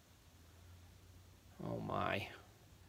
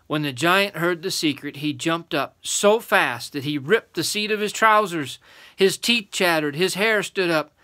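storytelling building higher and louder; excited narration; 5 semitones higher, 7 dB louder than usual. A narrator's reading. Adult reading the giant's fright, excited building narration.

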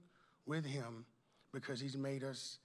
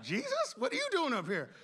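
very high, incredulous double take; Jesus, acted surprise; 12 semitones higher, 5 dB louder than usual. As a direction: squeal the question in mock astonishment. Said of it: "Jesus be like".

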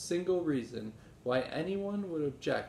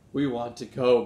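exclaiming; 9 dB louder than usual. Acted. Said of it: "Benny exclaimed".

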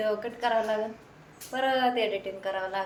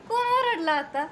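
calling out; 11 semitones higher, 5 dB louder than usual. Real, not acted. Calls out asking who shouted.

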